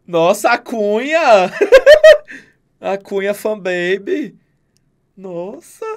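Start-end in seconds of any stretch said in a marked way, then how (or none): 1.2-2.4 high, loud burst of laughter. Real, not acted. Friend bursting into laughter at the cunha joke, shrieking laugh. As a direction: cackle out loud.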